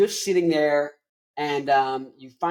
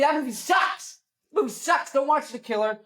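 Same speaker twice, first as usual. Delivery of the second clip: exasperated rant; 10 semitones higher than usual. Real, not acted. Ranting about movie.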